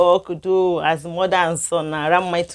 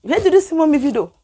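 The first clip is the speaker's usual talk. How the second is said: shocked question; 8 semitones higher, 4 dB louder, faster than usual. Retold or imitated. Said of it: "I was like".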